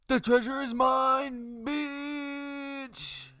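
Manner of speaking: screaming, high and loud; villain shout. Retold or imitated. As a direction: bellow it like a greedy pirate. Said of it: "Blackbeard's like".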